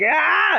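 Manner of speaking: sharp high yelp while crash landing; a quick startled ah as he falls; hammed-up falling scream; mock scream. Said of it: "He's like".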